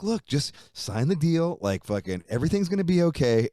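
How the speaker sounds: lightly voiced exec pressing him to sign; pushy label exec. Voicing label guy's plea.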